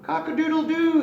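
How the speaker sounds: high, loud crowing rooster call. Acted. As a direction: crow it out like a proud little rooster. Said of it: "he cried".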